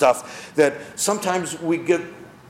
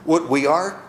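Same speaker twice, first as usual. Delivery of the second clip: usual speaking voice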